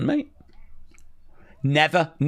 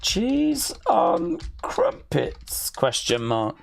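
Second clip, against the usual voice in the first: very slow, drawn out, pitched up; slow typing read-out; 5 semitones higher, 3 dB quieter, much slower than usual. Real, not acted. Streamer googling cheese on crumpets, slow read-out. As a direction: spell it out word by word as you type.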